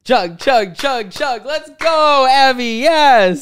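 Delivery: loud high party chant; frat bro. Acted. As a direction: hype up the chug like a frat bro. Cheering friend to chug espresso.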